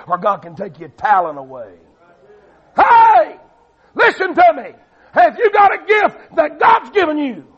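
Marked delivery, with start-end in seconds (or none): 2.8-7.5 high, loud shouted exhortation; full-force preaching. Real, not acted. Preacher urging the gifted to serve, full-force preaching. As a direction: seize the congregation's attention with a shout.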